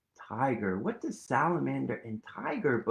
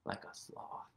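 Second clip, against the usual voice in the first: whisper; 14 dB quieter than usual. Shown as a demonstration. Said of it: "sloth hello demo".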